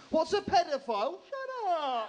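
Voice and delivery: high, loud mock child's blurt; hammed-up kid with no volume control. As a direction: imitate a little girl asking at full volume. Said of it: "She only works on child volume".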